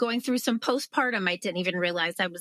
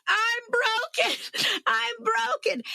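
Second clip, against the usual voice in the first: very high, slow, whiny wail; hammed-up despair; slower than usual. To husband John, laid out on the floor, hammed-up high whine. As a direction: whimper it like a dramatic wreck. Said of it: "I was like".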